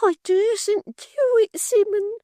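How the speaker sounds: very high, slow, tearful fake fright; a martyr bidding farewell, squeaking; Miggs, faint and fearful. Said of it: "cried Miggs".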